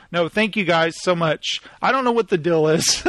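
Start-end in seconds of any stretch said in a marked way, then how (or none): none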